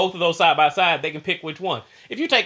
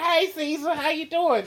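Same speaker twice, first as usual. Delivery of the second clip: put-on excited kid greeting dog; 11 semitones higher, slower than usual. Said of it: "be like".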